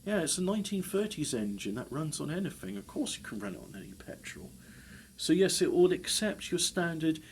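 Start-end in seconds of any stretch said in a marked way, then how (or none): 2.8-4.5 softer, lower, fast throwaway; lower, softer fast repeat to yourself; quiet aside. Real, not acted. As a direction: mutter it to yourself quickly.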